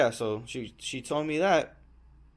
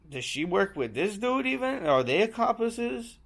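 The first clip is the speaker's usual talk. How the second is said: suspicious inner voice; 9 semitones higher than usual. Retold or imitated. Suspicious thought about the bartender.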